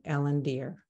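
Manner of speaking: normal talking voice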